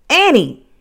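high, loud cry of her name; alarmed shout. A narrator's reading. Jack alarmed by Annie's shriek.

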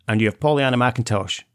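ordinary talk, nothing marked

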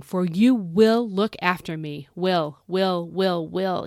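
slow, emphatic "will"; stress on will. Shown as a demonstration. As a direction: draw out and underline every "will". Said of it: "I gotta really stress that word".

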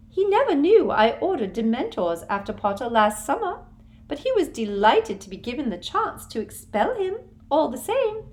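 a sugary official brushing off the law; Umbridge voice. A narrator's reading. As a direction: Umbridge dismissing Hermione. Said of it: "Umbridge's confession".

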